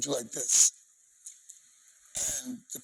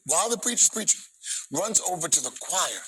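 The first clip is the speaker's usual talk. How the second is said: excited; 6 semitones higher than usual. Real, not acted. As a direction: let the excitement lift the line. Excited retelling, higher and louder.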